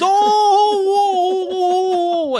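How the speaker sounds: high, loud, long "ohhh"; drawn-out mock scream of agony; Santa's agonised wail. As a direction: howl in hammed-up pain. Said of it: "And he goes".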